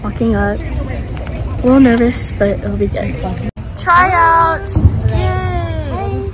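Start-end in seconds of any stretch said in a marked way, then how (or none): 3.8-6.3 excited cheering. Real, not acted. Cheering arrival at cheer tryouts.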